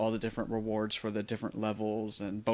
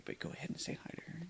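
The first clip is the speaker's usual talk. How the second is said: whisper; 5 semitones higher, 14 dB quieter, faster than usual. Acted. Nurse by sick child's bed.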